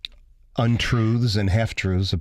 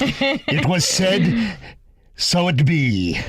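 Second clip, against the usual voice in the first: pirate voice; 6 semitones higher, slower than usual. Pretend. Playing pirate on Pirate Day.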